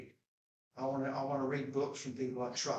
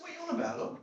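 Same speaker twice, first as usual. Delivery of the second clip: incredulous retort; 13 semitones higher, much faster than usual. Retold or imitated. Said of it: "And I said".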